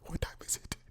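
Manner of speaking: breathy whisper to himself; whispered aside. Real, not acted. Host whispering an aside to himself.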